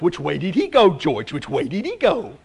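lightly voiced cartoon dog, asking eagerly; a hint of a dim dog hunting for the rabbit; dog asks Bugs Bunny which way the rabbit went, lightly voiced. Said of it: "the dog comes up and says".

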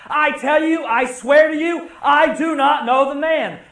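loud, high protesting oath; rising denial. Acted. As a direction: swear it at the top of your voice. Preacher acting Peter's denial.

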